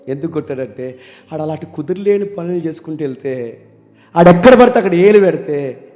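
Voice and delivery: usual speaking voice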